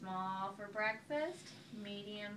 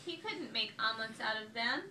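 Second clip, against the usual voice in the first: usual speaking voice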